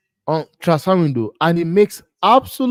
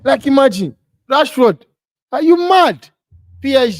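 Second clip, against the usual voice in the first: high, loud mocking disbelief; incredulous outburst; 8 semitones higher, 7 dB louder than usual. Real, not acted. Scoffing at a transfer rumour, loud and high. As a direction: shout it like the rumour is absurd.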